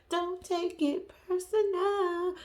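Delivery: slow teasing sing-song "Don't take it personal."; drawn-out sing-song. Shown as a demonstration. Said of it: "repeated drawn out".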